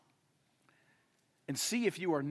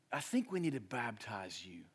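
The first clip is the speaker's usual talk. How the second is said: hushed, voiceless aside; a sly stage whisper; mock whisper; 5 semitones lower, 5 dB quieter than usual. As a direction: whisper it like a pushy secret suggestion. Mock aside voicing a pushy preacher.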